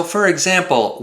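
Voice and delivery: usual speaking voice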